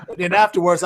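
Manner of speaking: usual speaking voice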